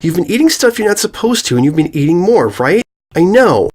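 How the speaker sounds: put-on high, earnest little girl voice; high accusing filly. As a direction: push out the accusation. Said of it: "cried as hard as she could muster".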